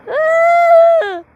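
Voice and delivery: high, loud yell of panic; panicked yell. Real, not acted. Player caught in the disaster, high loud panicked yell. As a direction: yell out in real fright.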